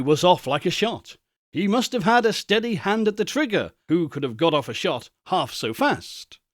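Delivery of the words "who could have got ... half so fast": plain reading voice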